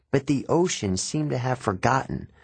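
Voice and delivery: ordinary delivery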